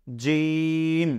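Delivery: "জিম" from mid-tongue with a long "ee". Shown as a demonstration. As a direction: stretch "জিম" slowly off the middle of the tongue. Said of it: "মাঝখান থেকে উচ্চারণ হবে".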